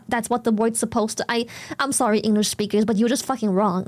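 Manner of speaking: ordinary talk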